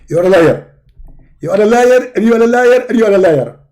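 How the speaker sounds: high, loud, indignant preacher voice; Zakir Naik impression. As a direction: bark it like a self-righteous debater. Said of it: "My name is Zakir Naik".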